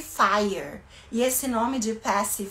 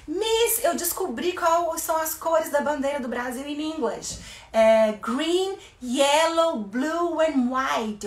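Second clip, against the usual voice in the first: put-on child voice; 4 semitones higher than usual. Voicing an excited child.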